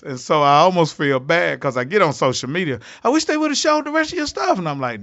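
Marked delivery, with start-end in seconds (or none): 3.0-4.6 fan voice. Retold or imitated. Fans' comments on social media.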